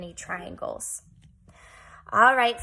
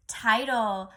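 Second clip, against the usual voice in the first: ordinary talk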